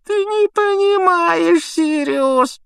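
very high, slow, loud whine; Pettigrew whimpering. A narrator's reading. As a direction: whimper like a cornered creature. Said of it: "проскулил Петтигрю". (протяжное тонкое скуление)

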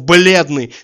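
ordinary delivery

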